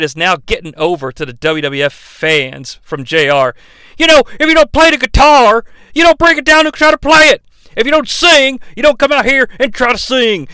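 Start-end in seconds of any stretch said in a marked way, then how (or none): 4.0-10.5 mock wrestler promo. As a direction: bark it like a cocky wrestler. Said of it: "quoting Jeff Jarrett".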